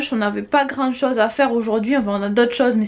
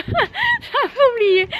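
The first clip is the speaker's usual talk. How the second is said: laughing; 10 semitones higher, much slower than usual. Real, not acted. Laughs at forgetting where she is.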